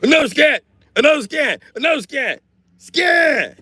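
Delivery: very high, loud shrieks; frenzied scream. Real, not acted. Streamer offering the AI another scan, frenzied screaming. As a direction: scream it in repeated bursts.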